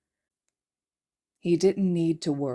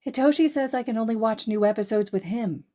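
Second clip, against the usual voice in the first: put-on little girl voice; 6 semitones higher than usual.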